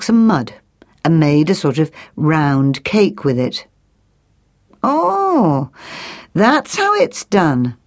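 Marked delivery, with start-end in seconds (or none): 4.8-7.8 higher, drawn-out realisation; a bird who thinks it has seen enough, slowly; thrush character. Acted. Thrush to the magpie teaching nests, pleased realisation.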